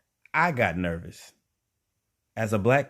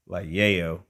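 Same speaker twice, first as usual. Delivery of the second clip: knowing hint; 3 dB louder than usual. Retold or imitated. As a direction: say yayo like a secret code word. Hinting at the drug to Mike.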